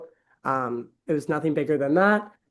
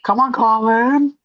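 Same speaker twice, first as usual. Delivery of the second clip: drawn-out playful call, louder and higher; teasing call; 6 semitones higher, 8 dB louder, slower than usual. Real, not acted. Teasing Colin over the call, slow playful loud cheer. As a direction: stretch it out like a hype chant.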